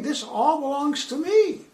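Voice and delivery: high gloating devil voice; Satan boasting. Acted. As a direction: crow like a tempter. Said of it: "But Satan here is saying".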